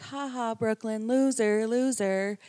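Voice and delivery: loud, high mock taunt with a laugh. Boy taunting his sister, loud high jeering. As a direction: jeer at her like a teasing kid brother. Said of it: "he's like".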